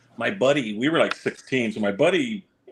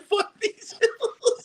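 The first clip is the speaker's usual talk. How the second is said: exasperated; much slower than usual. Retold or imitated. Giving up skating after crash.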